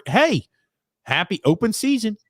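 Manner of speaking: usual speaking voice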